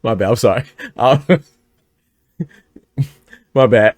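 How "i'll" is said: laughing. Real, not acted. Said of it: "I don't know why I just laughed".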